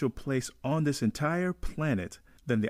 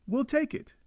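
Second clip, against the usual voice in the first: higher, stress on we; proud exclamation; 11 semitones higher than usual. Real, not acted. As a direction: say it proudly with the voice jumping up. Proud Jamaican claiming the film.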